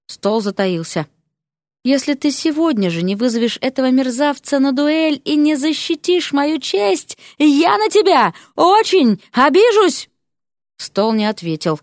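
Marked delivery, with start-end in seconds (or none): 1.8-10.1 outraged ultimatum. A narrator's reading. Lady threatens, building in outrage.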